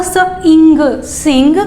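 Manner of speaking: स and इंग drawn out slowly, then joined as सिंग; teacher blending sounds slowly. Shown as a demonstration. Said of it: "तो क्या हुआ?".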